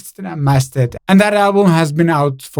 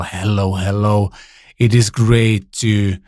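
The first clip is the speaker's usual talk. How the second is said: podcast voice test; 9 semitones lower than usual. Shown as a demonstration. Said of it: "Does this sound very podcasty".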